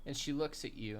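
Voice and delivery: normal talking voice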